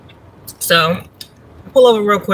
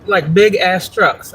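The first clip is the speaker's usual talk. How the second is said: ordinary talk, nothing marked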